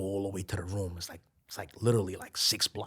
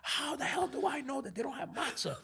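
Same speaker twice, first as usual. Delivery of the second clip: wife's retort; 5 dB quieter, faster than usual. Retold or imitated. Wife retorts.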